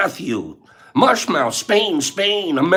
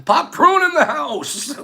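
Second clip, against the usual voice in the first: screaming; 9 semitones higher, slower than usual. Real, not acted. Streamer hypes up viewer Lad's birthday, high excited shouting. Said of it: "I'm screaming".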